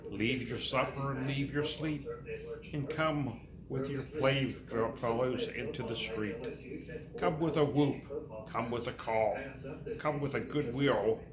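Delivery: normal talking voice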